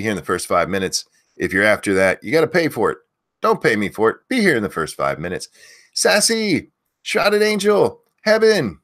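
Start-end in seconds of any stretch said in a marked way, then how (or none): 5.9-8.8 loud, high name call-outs; yelling names. Real, not acted. Host yelling chat names to early viewers, loud and high. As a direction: belt each viewer's name out. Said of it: "if you want me to yell your name".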